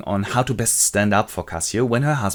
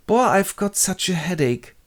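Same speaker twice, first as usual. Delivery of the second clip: lightly whiny, higher-pitched complaint; complaining husband; 8 semitones higher than usual. Acted. Husband complains to his wife of a headache, mildly pained. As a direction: moan mildly about a headache.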